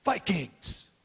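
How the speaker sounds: high, called-out cheer; captain's huddle call; retold rallying cry. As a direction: fire up the team one last time. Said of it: "Jim Marshall said".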